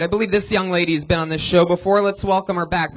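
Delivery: ordinary talk, nothing marked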